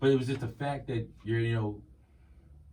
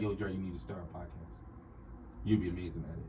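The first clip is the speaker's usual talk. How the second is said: low, soft, smooth register; put-on deep sex-appeal voice; 5 semitones lower, 8 dB quieter, faster than usual. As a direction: murmur it deep and unhurried.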